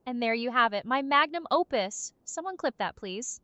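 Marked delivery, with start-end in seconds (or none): none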